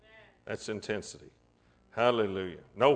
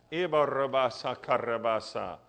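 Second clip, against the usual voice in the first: speaking in tongues; 2 semitones lower than usual. Real, not acted. Preacher praying in tongues.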